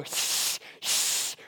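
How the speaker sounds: unvoiced hiss at a bad actor. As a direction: hiss them off the stage. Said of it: "describe hissing at an actor".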